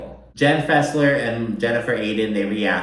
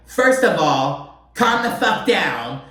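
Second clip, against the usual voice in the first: slow, much higher mock snap; angry outburst; 7 semitones higher, 4 dB louder, slower than usual. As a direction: spell out each word like she's losing it. Imitating Danielle's angry outburst.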